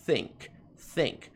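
"think" with a clear lisped th; tongue between the teeth to begin "think"; careful, isolated "think" with soft th; th sound demo. Shown as a demonstration. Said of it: "é esse som do T H".

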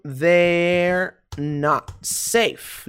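stretched-out "they're not safe"; very slow model. Shown as a demonstration. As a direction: drag each word out for learners. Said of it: "slow model phrase". (bem devagar, palavra por palavra)

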